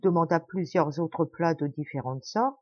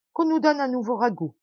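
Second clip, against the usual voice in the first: higher, louder order; raised voice; 6 semitones higher, 4 dB louder than usual. A narrator's reading. The host orders a new stew in a raised voice. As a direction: keep calling out for more. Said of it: "en haussant la voix".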